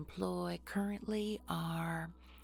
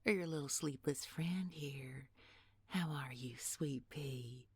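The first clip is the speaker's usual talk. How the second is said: menacing whisper. Acted. Villain taunting the captives.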